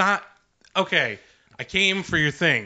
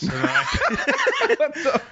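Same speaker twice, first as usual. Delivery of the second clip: laughing; 12 semitones higher than usual. Real, not acted. Laughing while speaking.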